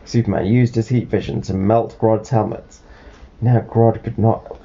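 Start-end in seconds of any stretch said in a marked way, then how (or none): none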